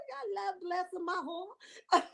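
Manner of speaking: slow, laughing and half crying with joy; overwhelmed by the viewers' kindness; touched laugh. Real, not acted. Host thanking viewers for a gift, laughing and teary.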